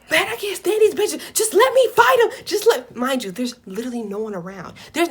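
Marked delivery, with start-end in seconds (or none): 0.1-3.0 high, whining rant of a furious friend; mock-frantic outburst, pitched way up; imitated angry yelling. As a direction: beg to be let loose, hammed up.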